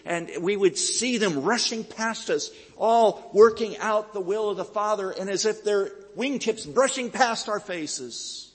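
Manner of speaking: preacher swept up in Calvin's vision of angels; awed wonder. Retold or imitated. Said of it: "and Calvin says".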